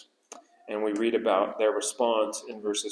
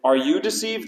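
mocking put-down; 6 semitones higher than usual. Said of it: "they put them down".

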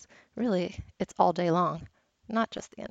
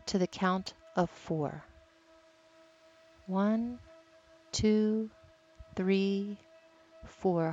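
guided meditation; 6 dB quieter than usual. Acted. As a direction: gently count the breaths, calm. Leads a breathing meditation.

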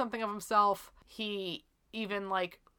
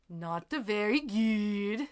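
slow, lightly done Swedish accent; 2 semitones higher, much slower than usual. Shown as a demonstration. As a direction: try a mock Swedish accent. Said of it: "It's pretty good".

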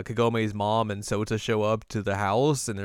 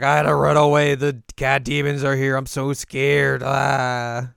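high, loud, hammed-up panic; mock scared; 4 semitones higher, 8 dB louder than usual. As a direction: play a cowardly fox demon fleeing. Voicing scared Hachi.

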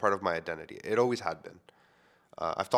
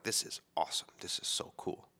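hushed awe; 8 dB quieter than usual. Retold or imitated. Teen self reacting to book.

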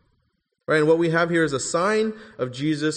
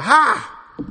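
a sharp, high, loud bark of "Ha!"; demon's sudden cry; 10 semitones higher, 6 dB louder than usual. Acted. Demon shouting at Jesus in the synagogue, a sudden loud cry. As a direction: burst out with it like a demon interrupting. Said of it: "a voice that just comes out".

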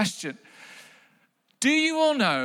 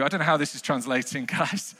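ordinary delivery

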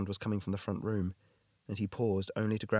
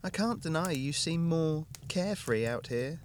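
lightly higher woman's voice; wife voice; 7 semitones higher than usual. A narrator's reading. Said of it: "Hannah speaking".

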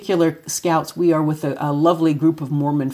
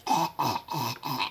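soft strained croaks fading away; mother faking lost voice, croaking; faked voiceless croak; 4 semitones lower, 10 dB quieter than usual. Shown as a demonstration. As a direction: croak as if the throat won't work. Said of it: "And I would, like".

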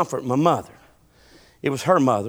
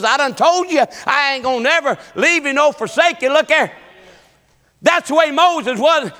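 raised, building pulpit voice; fervent preaching; 10 semitones higher, 5 dB louder than usual. Acted. Preacher voicing the Lord offering promises, fervent preaching. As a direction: preach the promises with mounting force.

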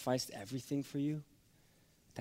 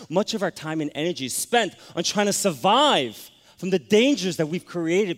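much louder, driving preacher's warning; preaching at full force; 6 semitones higher, 10 dB louder than usual. Acted. Preacher warning the congregation about love of money, loud and forceful. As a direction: press the point hard to the congregation.

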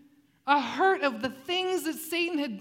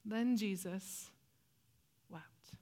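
solemn hush; 3 semitones lower, 6 dB quieter, slower than usual. Acted. Solemn reading of Jesus weeping.

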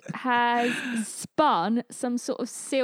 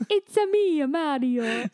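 Mario voice; 3 semitones higher than usual. Pretend. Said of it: "Give me something to say".

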